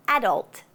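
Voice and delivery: "adult" stressed on the first syllable, AD-ult. Shown as a demonstration. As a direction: hit the "a" of "adult". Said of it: "emphasize the first syllable".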